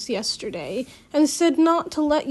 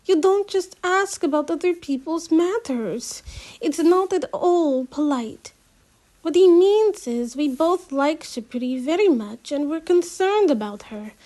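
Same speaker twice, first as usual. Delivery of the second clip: higher, louder prim scolding; 5 semitones higher, 4 dB louder than usual. Acted. Girl scolding her friend for rude questions, prim and higher. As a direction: lecture him on manners. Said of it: "Simi scolds".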